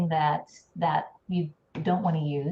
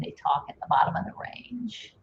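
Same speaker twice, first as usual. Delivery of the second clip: creaky, scratchy tail on the phrase; glottal fry; 5 dB quieter than usual. Shown as a demonstration. Speech coach shows what fry sounds like, creaky. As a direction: let the voice sink into a soft crackle. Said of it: "can you hear that?".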